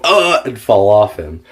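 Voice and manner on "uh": a sharp high grunt, as if hit on the head; mock hurt grunt from the blow; retold pained grunt. Boy smacked from behind grunts high and sharp. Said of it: "to where I go".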